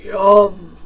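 loud, savoring bear voice; relishing bear. A narrator's reading. Bear anticipates lunch, big loud relish. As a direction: relish it loudly as a hungry bear.

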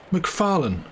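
sharp, high shocked cry of a name. A narrator's reading. Fettes to Macfarlane, horrified at the murder hint, shocked cry. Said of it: "cried Fettes".

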